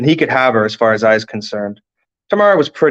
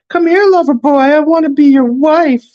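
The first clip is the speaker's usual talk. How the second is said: very high, loud swooning squeal; mock lovestruck falsetto; 5 dB louder than usual. A narrator's reading. Best friend teasing Ian about his crush, mock lovestruck falsetto. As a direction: tease in a screechy lover's falsetto. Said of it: "his voice rising several octaves".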